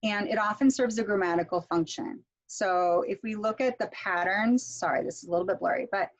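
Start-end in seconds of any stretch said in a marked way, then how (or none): none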